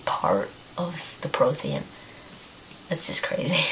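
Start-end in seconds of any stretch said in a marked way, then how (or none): 2.9-3.6 losing voice. Real, not acted. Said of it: "I'm pretty much losing my voice".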